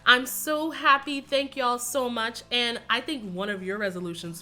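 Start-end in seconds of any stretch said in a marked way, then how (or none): none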